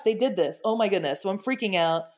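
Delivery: usual speaking voice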